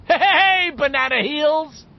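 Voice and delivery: high, loud gleeful jeer; triumphant shout. A narrator's reading. Richie jeering at fallen Henry, delirious with triumph. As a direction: crow it out in triumph. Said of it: "Richie shouted, delirious with triumph".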